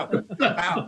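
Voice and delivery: ordinary talk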